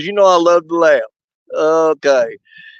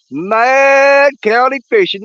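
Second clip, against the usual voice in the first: drawn-out shout-out; 5 semitones higher, much slower than usual. Real, not acted. Shout-out to a viewer.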